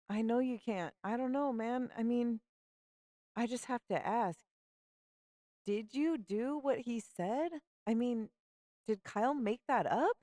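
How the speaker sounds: put-on high, hesitant girl voice, pressing gently; Simone, hesitant. Simone awkwardly asks her friend about the rumor, high hesitant voice. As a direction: ask the awkward question carefully.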